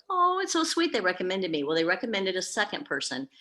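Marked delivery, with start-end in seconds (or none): 0.1-1.6 high, sugary gush; imitating her own delighted reaction, gushing; mock sweet gushing. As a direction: gush it up high as if it's adorable. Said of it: "I was like".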